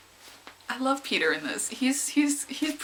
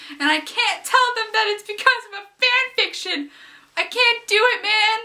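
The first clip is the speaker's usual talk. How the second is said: giddy squeal; 12 semitones higher, 6 dB louder than usual. Real, not acted. Giddy about fan fiction at work.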